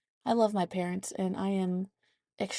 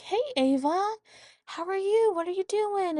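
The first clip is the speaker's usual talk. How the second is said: high grandma voice; 12 semitones higher than usual. Retold or imitated. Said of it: "And she was like".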